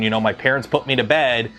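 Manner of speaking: ordinary talk, nothing marked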